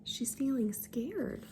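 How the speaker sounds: hushed unvoiced aside; soft secret whisper. Shown as a demonstration. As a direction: lean in and whisper it to the kids. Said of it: "aside to listeners, whispered".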